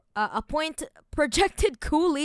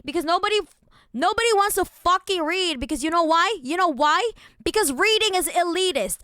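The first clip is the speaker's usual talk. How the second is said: rising rant; 5 semitones higher, 4 dB louder than usual. Real, not acted. Ranting about not reading.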